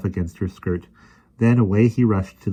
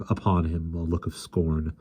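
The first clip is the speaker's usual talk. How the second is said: usual speaking voice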